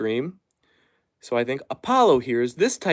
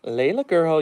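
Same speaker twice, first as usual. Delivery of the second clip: gentle dog talk; 3 semitones higher than usual. Real, not acted.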